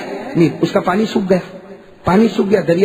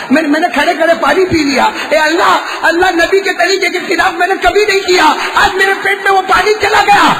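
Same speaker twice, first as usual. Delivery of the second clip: impassioned prayer cry; 11 semitones higher than usual. Acted. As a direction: plead aloud in prostration. Umar's tearful prayer, preached.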